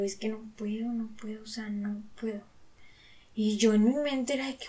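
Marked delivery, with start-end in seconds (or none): 0.0-2.4 soft, defeated near-whisper; barely able to get the words out; helpless refusal. Retold or imitated. To the accountant, admitting she can't stand up for herself, hushed and defeated.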